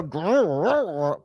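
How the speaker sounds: imitated snarling growl; mock dog growl. Commentator mimicking the woman in the clip, mock growl. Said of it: "she turns into a, one of Michael Vick's dogs".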